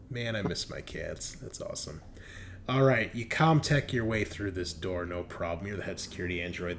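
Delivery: normal talking voice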